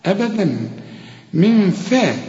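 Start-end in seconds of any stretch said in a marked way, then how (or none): 1.3-2.1 من فا with a thin, fronted "fae"; light faa. Shown as a demonstration. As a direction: correct the ف to a light sound in من فا. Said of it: "وفي كل القرآن مرققة". (من فا بفاء مرققة)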